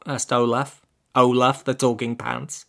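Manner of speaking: usual speaking voice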